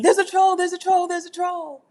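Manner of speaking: high, loud mock alarm; hammed-up panic, pointing at the troll; alarmed chat. Mimicking old chat members yelling about a troll, mock alarm. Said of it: "y'all used to be like".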